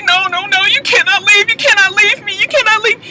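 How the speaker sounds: high mock desperate pleading; pleading wife. Recapper voicing the wife begging her husband to stay, high.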